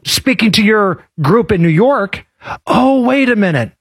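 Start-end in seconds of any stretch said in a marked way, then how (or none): none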